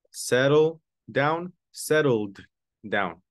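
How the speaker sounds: "settled" with a clear final D; final D sound. Shown as a demonstration. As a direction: slowly contrast "Settle down" and "settled down". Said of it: "You add a D, the D sound".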